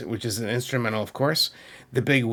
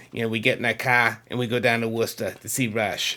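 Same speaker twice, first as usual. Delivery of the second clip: R-dropping Massachusetts accent; demonstrated New England accent, "cah" for car; faster than usual. Shown as a demonstration. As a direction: put on a local Massachusetts accent, dropping the R's. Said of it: "that's how we say things up there".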